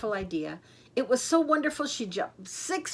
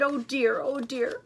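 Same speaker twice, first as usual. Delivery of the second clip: mild crying; 5 semitones higher than usual. A narrator's reading. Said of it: "she started to cry again".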